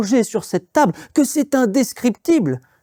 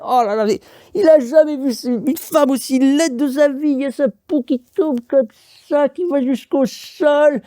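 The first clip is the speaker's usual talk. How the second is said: horrified description; 6 semitones higher than usual. A narrator's reading. Narrator horrified by ugly witch.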